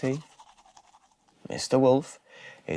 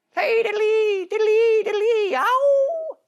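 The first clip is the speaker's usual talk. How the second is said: squeaky fiddle sound; 10 dB louder, slower than usual. Acted.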